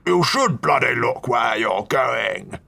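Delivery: put-on gruff old duke shouting. Cranky old duke shouts at the clumsy stranger. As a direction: bellow it like a cranky old aristocrat.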